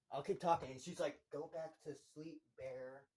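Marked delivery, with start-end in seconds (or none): 1.3-3.1 very soft, eerie coaxing; creepy hush. Retold or imitated. Nikki telling Bear from the corner, eerily hushed. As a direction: murmur it softly from the dark. Girl speaking from dark corner.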